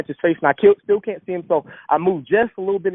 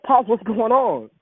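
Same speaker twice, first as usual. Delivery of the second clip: pleading to dad; 8 semitones higher, slower than usual. Retold or imitated. Pleading with homeless father.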